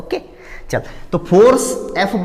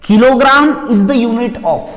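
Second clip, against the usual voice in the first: higher, louder and very slow, each word stretched; drawn-out emphatic prompt; 6 semitones higher, 6 dB louder, much slower than usual. Shown as a demonstration. Teacher prompting students to finish the sentence, loud and very slow. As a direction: call it out slowly to the class, leaving the end open. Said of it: "चल ध्यान से सुनेंगे".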